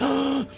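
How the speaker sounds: a sharp, high, hammed-up gasp for air; mock high gasp. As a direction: gasp like you forgot to breathe. Mock gasping for breath.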